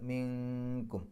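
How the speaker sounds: മിൻകും with the n hidden in a soft nasal hum before k; hidden nasal ikhfa. Shown as a demonstration. Teacher demonstrating ikhfa softly to students. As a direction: hum the n of മിൻകും, don't close it. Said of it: "മറച്ചു വെച്ചുകൊണ്ട് മണിക്കുക".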